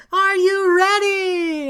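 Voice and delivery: high, drawn-out shout to a crowd; hype-man shout. Pretend. Acting out midnight launch countdown.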